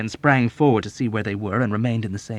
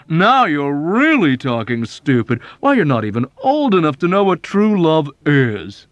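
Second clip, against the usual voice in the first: condescending Arfie; 6 semitones higher, 8 dB louder than usual. Acted. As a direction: talk down to a child.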